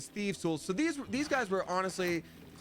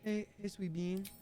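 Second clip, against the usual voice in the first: tender; 6 semitones higher, 5 dB quieter than usual. Real, not acted. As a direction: coo softly at a pet. Greeting a pet.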